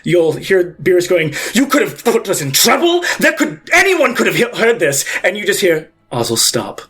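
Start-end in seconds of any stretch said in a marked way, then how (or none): 1.5-5.0 higher, hurried, half-laughing scold; panicked scolding companion. Acted. Beerus voiced by GM. 6.1-6.8 cold command. Acted. Pontiff commands.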